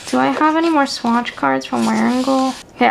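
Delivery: ordinary talk, nothing marked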